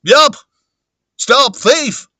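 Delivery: high, loud alarmed shout; Huckster. Acted. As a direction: raise the alarm in character. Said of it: "Okay, Huckster".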